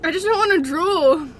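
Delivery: normal talking voice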